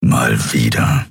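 very low, slow rumble; slow sullen creature voice; grumbling giant. A narrator's reading. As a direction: mutter it deep and drawn out. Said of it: "brummte der Skrier".

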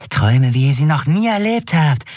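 high, wheedling junkie voice; sleazy dealer. Acted. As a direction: lure them in like a creepy peddler. Dealer preist Drogen an. (hoch und schmierig lockend)

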